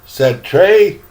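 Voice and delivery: ordinary delivery, nothing marked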